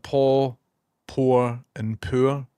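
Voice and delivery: "paw", "poor" and "poor" each said apart with its own vowel; three distinct vowels. Shown as a demonstration. Scottish streamer testing viewers, keeping "paw", "poor", "poor" clearly distinct. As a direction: separate "paw", "poor" and "poor" slowly and carefully. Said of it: "your accent just merges all of them together".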